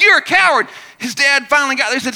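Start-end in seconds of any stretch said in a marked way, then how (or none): 0.0-0.7 high, loud echo of a taunt; imitated echo yell; echoed shout. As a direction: fling it back like an echo. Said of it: "The voice came back".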